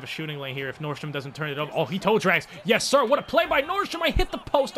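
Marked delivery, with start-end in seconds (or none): 2.6-4.8 excited. Real, not acted. Excited play then near miss.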